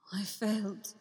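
soft breathless whisper; Obi-Wan lying defeated on the ground, whispered thought. Acted. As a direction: barely breathe the words, defeated. Obi-Wan's dying thought.

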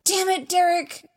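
high, loud exasperated outburst; loud, high-pitched cry of annoyance; frustrated yell. A narrator's reading. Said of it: "he yells".

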